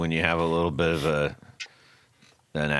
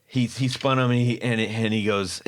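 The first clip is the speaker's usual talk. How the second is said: normal talking voice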